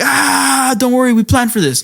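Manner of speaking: high drawn cry, then quick reassurance; squeal, then rattle off the plan high; mock alarm. Couple answering the flustered sister, high comic alarm. Voicing the couple's panicked reply.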